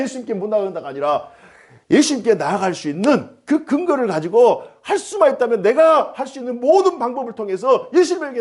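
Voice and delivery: high-pitched, insistent sermon delivery; impassioned preaching. Real, not acted. (높은 음으로 힘주어 설교하듯)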